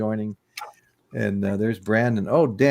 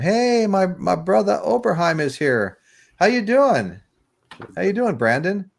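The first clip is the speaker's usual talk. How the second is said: much higher, loud happy hello to a friend; excited greeting; 9 semitones higher, 3 dB louder than usual. Real, not acted. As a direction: greet a buddy who just showed up. Excitedly greeting arriving viewer.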